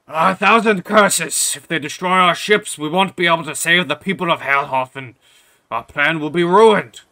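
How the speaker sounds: loud, high, raging outburst; furious shouted curse; enraged king. Acted. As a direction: bellow in royal fury. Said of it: "like, a rage as he says".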